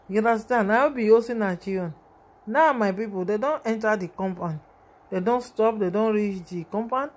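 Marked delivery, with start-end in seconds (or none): none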